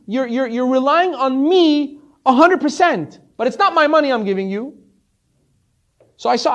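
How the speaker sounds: high, loud, insistent explanation; emphatic insistence. Retold or imitated. Rich man tells the desperate visitor he can't help, emphatic insistence.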